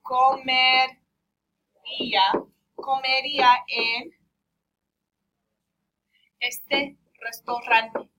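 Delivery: loud, drawn-out Spanish syllables; slow Spanish modelling. Shown as a demonstration. Teacher builds the translation for class, slow Spanish modelling. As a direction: sound out each Spanish word slowly and firmly.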